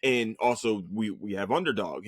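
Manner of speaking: ordinary delivery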